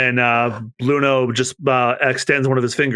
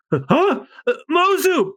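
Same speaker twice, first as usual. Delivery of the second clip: higher startled cry for Mozu; sister cries out; 13 semitones higher, slower than usual. Acted. Kiwi sees Mozu sent flying, startled.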